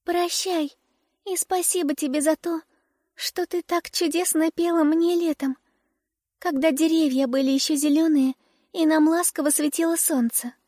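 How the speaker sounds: put-on high, gentle little girl; sweet tiny girl saying farewell. A narrator's reading. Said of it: "сказала дюймовочка".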